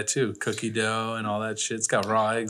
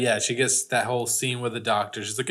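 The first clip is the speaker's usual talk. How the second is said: ordinary talk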